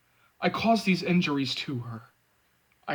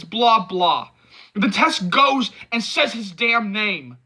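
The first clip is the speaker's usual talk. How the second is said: angry rant; 6 semitones higher, 4 dB louder than usual. A narrator's reading.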